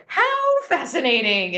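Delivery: high, slow, delighted "How fascinating"; animated coach. Retold or imitated. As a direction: throw up your hands and marvel at the mistake. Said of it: "And he throws up his hands like this".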